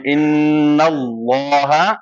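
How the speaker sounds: اللہ with thick, heavy L, drawn out slowly; heavy Allah. Shown as a demonstration. Teacher showing students the recitation, slow heavy Allah. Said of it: "لفظ اللہ ہم موٹا پڑھ رہے ہیں".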